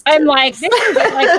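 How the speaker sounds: real laughter through high-pitched words; laughing. Real, not acted. Co-host stumped by complicated question, laughing as they talk. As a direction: burst out laughing while trying to answer.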